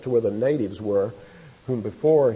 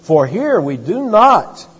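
"not" stressed, higher, louder, slow; 5 semitones higher, 8 dB louder, slower than usual. Shown as a demonstration. Preacher reading the verse and stressing "not", loud and slow. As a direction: hit the negative "not" hard. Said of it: "emphasis on the negative".

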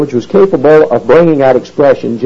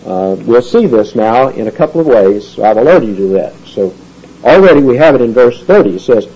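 normal talking voice

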